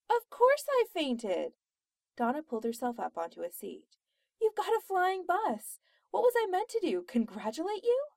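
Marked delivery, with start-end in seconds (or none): 0.1-1.5 high, loud, drawn-out exasperation; indignant outburst. Acted. Indignant comeback after fainting. 4.4-8.1 loud, high-pitched exasperated outburst; indignant disbelief. Acted. Indignant shock at flying bus.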